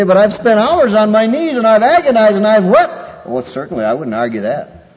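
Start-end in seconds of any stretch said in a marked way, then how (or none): 0.0-3.3 higher, louder hammed-up lament; mock anguished protest. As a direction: parody the weeping pray-er.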